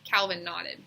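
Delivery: plain reading voice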